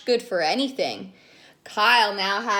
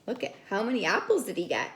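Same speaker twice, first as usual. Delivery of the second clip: ordinary delivery, nothing marked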